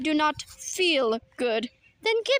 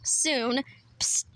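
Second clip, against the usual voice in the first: ordinary talk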